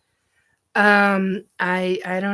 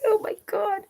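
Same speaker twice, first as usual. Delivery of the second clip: much higher oh my God; shocked squeal; 13 semitones higher than usual. Real, not acted. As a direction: squeal it out scandalized. Reacting to sex swing joke.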